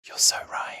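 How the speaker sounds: mock-confiding whisper; whispered reply. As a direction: whisper it like a secret agreement. Imagined listeners tweeting agreement.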